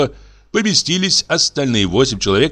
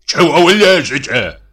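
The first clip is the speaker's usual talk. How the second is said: loud, raised-pitch rebuke; general's shout; 7 semitones higher, 5 dB louder than usual. A narrator's reading. General blocking the carriage, indignant shout. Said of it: "крикнул генерал".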